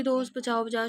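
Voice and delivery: ordinary delivery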